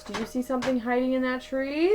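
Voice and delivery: usual speaking voice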